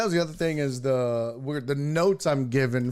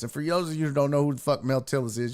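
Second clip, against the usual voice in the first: normal talking voice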